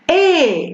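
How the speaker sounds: "E" as a lengthened model vowel. Shown as a demonstration. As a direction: hold the vowel "E" longer. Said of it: "Il est plus lent".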